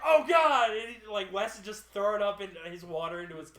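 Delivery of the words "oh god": imitating a loud, high cry of horror; disgusted outcry. Friend seeing vomit in the cab, appalled yell.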